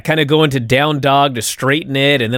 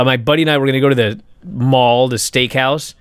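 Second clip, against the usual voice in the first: ordinary talk, nothing marked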